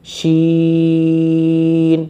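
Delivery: با and تا held long, one breath each; slow Quran teacher drawing out each letter; drawn-out letter names. Shown as a demonstration. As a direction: stretch با then تا out slowly. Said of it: "پوری ایک سانس میں پڑھنا ہے".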